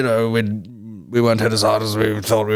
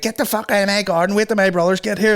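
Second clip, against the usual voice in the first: much higher shrill scolding woman; mock angry Belfast woman; 10 semitones higher than usual. Woman yelling at thieving kids, a high scolding Belfast voice. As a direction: yell at the kids like a furious housewife. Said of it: "the woman was like".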